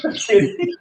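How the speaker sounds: squeaky high mock of the line; teasing high-pitched echo. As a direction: parrot it back way up high. Echoes partner's line, much higher.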